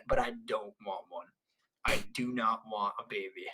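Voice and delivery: low, quiet, deliberate refusal; softly laying down a firm no. Retold or imitated. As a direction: drop the voice right down to decline. Firmly declining to have babies.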